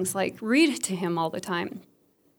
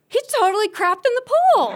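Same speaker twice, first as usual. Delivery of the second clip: horrified realization; 13 semitones higher, 6 dB louder than usual. Retold or imitated. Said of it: "I go".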